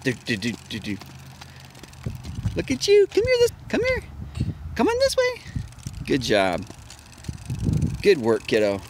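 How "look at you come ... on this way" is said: very high, rising come here. Real, not acted. Dad coaxes toddler.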